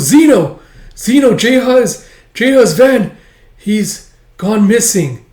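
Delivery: high, loud, frantic cry for Zeno; dreaming girl shouting to a friend; desperate calling. Acted. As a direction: shout the news out in panic. [name] calls out in dream.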